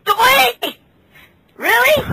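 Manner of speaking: high, loud mock disbelief; can't believe what they just said; incredulous. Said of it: "I'm like".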